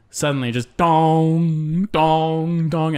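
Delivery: slow tolling bell imitation; church bell dong. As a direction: ring out each dong slowly.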